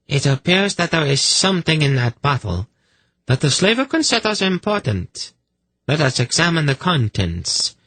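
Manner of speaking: high-pitched, commanding priestess voice; imperious accuser. A narrator's reading. Priestess ordering her man to examine the bottle, imperious. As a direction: pronounce it like a high, haughty inquisitor.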